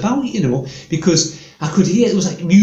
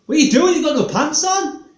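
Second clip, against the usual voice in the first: incredulous onlooker; 11 semitones higher than usual. Retold or imitated. Onlookers shouting at pantless man.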